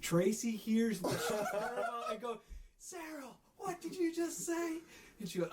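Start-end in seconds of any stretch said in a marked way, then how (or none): 2.8-4.8 high, slow, mock-stern boss voice calling her name; boss catching her out. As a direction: play the boss who just overheard, drawn out. Boss Tracy voiced catching Sarah.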